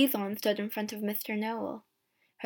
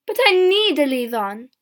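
loud, raised-pitch shout; 4 semitones higher, 11 dB louder than usual. A narrator's reading. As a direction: shout a protest from the doorway. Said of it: "my mother shouted from the door".

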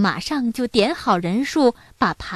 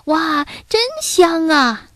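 high, drawn-out exclamation; excited cry; 7 semitones higher, slower than usual. A narrator's reading. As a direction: cry out at a delicious smell, higher and slower. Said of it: "他们叫起来". (兴奋地拉长声音惊叹)

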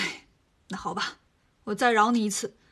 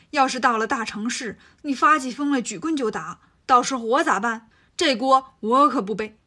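building scolding; 5 dB louder than usual. Acted. Tang Seng scolds Monkey.

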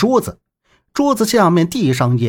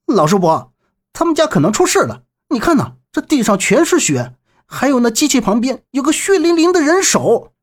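urgent, higher-pitched warning; frowning concern; 7 semitones higher than usual. A narrator's reading. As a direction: warn the old uncle anxiously, pitch rising. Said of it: "周震皱眉冲他说道".

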